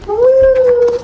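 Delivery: high, loud delighted woo; excited whoop. Real, not acted. Reader cheering at a twist in her book, excited whoop. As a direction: let out a thrilled cheer.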